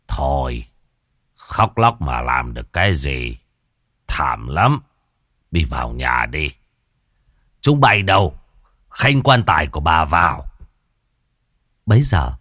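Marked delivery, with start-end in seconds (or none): 0.1-10.4 haughty and condescending. Acted. Old Khiêm lording it over his wife's relatives, haughty. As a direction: talk down to the in-laws. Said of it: "Lão lên bộ trịch thượng".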